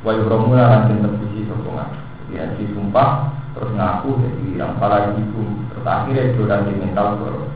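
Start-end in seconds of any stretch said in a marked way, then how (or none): none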